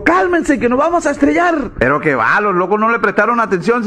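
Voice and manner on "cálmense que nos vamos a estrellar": pilot yelling. Acted. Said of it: "el piloto los gritó".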